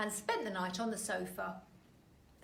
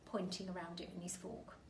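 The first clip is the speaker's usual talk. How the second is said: ordinary delivery, nothing marked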